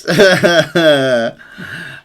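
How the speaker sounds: loud, high burst of laughter. Real, not acted. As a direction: laugh out loud after the praise. Laughing after praising the team's win.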